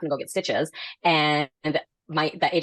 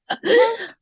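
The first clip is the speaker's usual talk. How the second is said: much higher squeal of laughter; 4 dB louder than usual. Real, not acted. Laughing at the seller's dinner offers, squealing. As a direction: let out a shrill laughing squeal.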